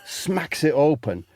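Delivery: normal talking voice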